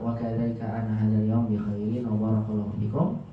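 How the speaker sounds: normal talking voice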